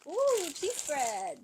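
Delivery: drawn-out, loud ooh of delight; real thrilled discovery; excited delight. Real, not acted. Delighted at unboxing find.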